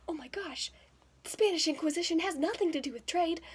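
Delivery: loud, high retold disbelief; incredulous mock protest; exasperated outburst. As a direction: blurt it out in disbelief.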